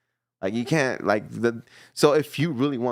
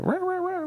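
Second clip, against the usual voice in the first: high yappy dog barks. Retold or imitated. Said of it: "the dog is like".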